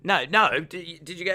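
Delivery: ordinary talk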